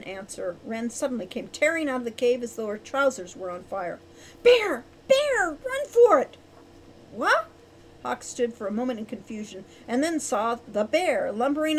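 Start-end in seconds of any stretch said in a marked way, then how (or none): 4.4-6.4 high, loud alarm cry; panicked shout. A narrator's reading. Shouting warning of a bear. 7.1-7.5 high, loud startled question. A narrator's reading. Startled by the bear warning.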